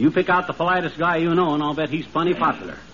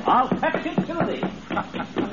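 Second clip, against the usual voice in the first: courtly gentleman; 4 semitones higher, much slower than usual. Acted. [name] playing courtly gentleman.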